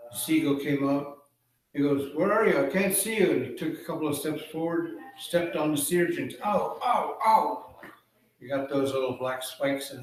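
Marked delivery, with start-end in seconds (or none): 2.1-3.3 louder, higher searching call; raised, puzzled character voice. Acted. Seagull calls out looking for Raven. 6.4-7.8 high acted "ow" cries; storyteller's mock cries of pain; pained yelps. Seagull steps on sea urchins.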